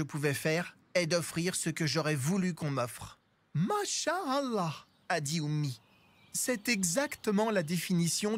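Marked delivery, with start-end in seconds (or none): 3.5-4.9 slow, admiring mother. A narrator's reading. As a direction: say it slowly, impressed. Oummi voiced, slow.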